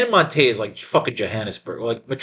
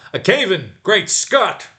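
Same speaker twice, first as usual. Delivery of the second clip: alarmed shout; 7 semitones higher, slower than usual. Acted. Alarm at the cave-in.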